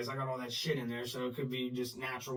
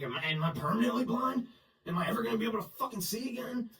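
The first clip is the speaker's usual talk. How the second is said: frantic inner voice, higher, fast; panicked; 9 semitones higher, faster than usual. Retold or imitated. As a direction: race through the panic in your head. Panicked thoughts while blinded.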